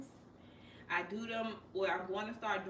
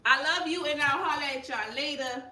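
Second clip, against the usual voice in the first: loud, higher farewell ending in a long stretched last word; exuberant sign-off; 4 semitones higher, 8 dB louder, much slower than usual. Real, not acted. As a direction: call out goodbye and hold the final word. Calling goodbye as stream ends.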